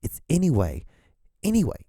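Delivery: "anyway" repeated firmly, no s on the end; corrective ANYWAY. Shown as a demonstration. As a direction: drill the singular "anyway", ending on WAY. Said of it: "It's just singular".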